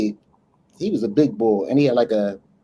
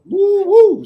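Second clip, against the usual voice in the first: shocked woo; 6 dB louder than usual. Retold or imitated. Reacting to the girl's appearance.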